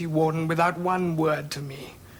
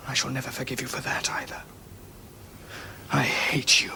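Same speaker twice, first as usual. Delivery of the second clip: whisper; 4 semitones lower, 4 dB quieter than usual. Acted. Son's bitter accusation at father.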